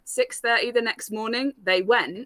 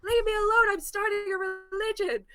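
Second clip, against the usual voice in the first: much higher cartoon sidekick voice, playful; Tails from Sonic voice; 11 semitones higher than usual. Drunk giant brushing off his friends, in a high cartoon voice. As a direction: pitch way up like a cartoon fox sidekick. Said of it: "Did everyone sound like Tails from Sonic?".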